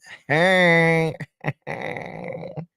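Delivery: high-pitched drawn-out laugh. Real, not acted. Laughing at a viewer's comment.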